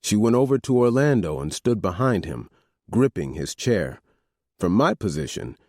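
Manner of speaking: ordinary delivery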